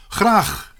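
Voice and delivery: high, prompt, eager yes; eager acceptance. A narrator's reading. Boy invited to play in the match, eager. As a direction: jump at the offer. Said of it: "zei hij onmiddellijk".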